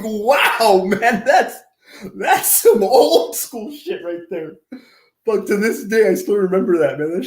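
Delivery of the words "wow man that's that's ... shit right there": higher, slow laid-back dude voice; amused surfer-dude voice. Retold or imitated. Reacting to her childhood dream, a lazy dude drawl. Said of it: "I was like".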